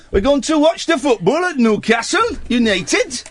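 high-pitched, hammed-up Newcastle accent; over-the-top Geordie, pitched very high. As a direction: shoot the pitch right up, broad Geordie football fan. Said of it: "this is one of my weak ones".

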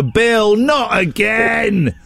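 imagined wife wailing, final word drawn out; exasperated wife. Pretend. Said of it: "his wife looks down and go".

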